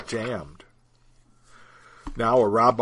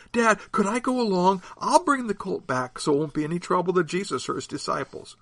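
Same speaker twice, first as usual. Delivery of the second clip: put-on eager boy; 8 semitones higher than usual. Boy begging his father.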